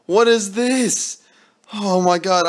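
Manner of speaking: high and slow, drawn out; can't believe his luck; stunned disbelief. Real, not acted.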